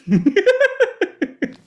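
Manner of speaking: sharp high-pitched laugh. Real, not acted. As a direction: let out a squeaky laugh. Laughs mid story.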